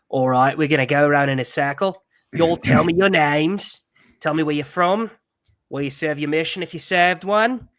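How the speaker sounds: hammed-up imitation of a Kiwi accent; fake New Zealand accent. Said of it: "pretending that he has a New Zealand accent".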